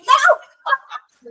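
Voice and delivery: a sudden high, loud "Não!"; startled no. Retold or imitated. Answering God in the bathroom, startled high protest. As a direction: blurt it out high and loud, caught off guard.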